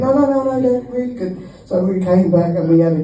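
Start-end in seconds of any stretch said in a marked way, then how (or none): 0.0-1.5 insisting Danny. Retold or imitated. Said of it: "He went".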